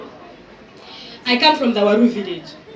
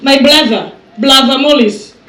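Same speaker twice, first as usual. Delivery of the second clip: louder call across the room; 10 dB louder than usual. Real, not acted. MC calling out to brother Morris, louder. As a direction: hail him from across the hall.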